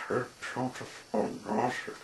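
ordinary delivery, nothing marked

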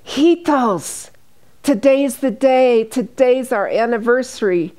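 high, bright-eyed announcement; eager old woman. Retold or imitated. Dying woman telling family today's their anniversary, high eager voice.

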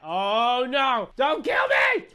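loud shriek climbing very high; panicked plea. Acted. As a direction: beg for your life in mock terror. Begging the game's death laser.

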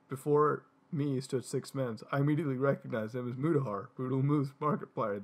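normal talking voice

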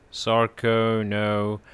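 normal talking voice

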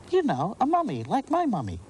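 put-on high, eager little girl; a four-year-old explaining earnestly. Natalie explaining mummy to Abigail, high child voice.

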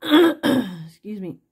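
loud throat clearing. Real, not acted. Seller clearing her throat mid-sale, loud throat clearing. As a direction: clear the throat hard, excuse yourself. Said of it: "my voice is all screwed up".

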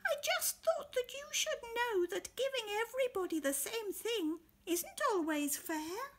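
very high, small helpful voice; very high wise little beetle; tiny Beetle. Acted. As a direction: speak up from the ground, squeaky.

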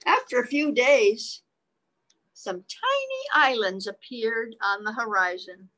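put-on lively read-aloud voice for children; reading the book to little listeners; story narration. A narrator's reading. Parent reading a picture book to children, lively read-aloud voice.

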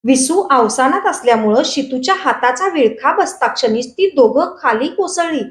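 usual speaking voice

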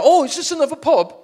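put-on high, loud, breezy party-girl question; mock drunk girl. Girl passing at night, asking loud and high about a pub. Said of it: "and they go".

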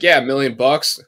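loud mock smug customer; smug joker. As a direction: deliver the tired joke smugly. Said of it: "they're just like".

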